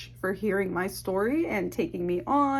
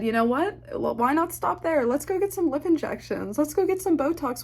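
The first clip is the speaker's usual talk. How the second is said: higher, bubbly retelling of a reckless plan; light and eager, talking herself into it; a mock-excited version of her past self; giddy excitement; 6 semitones higher than usual. Retold giddy plan for cosmetics.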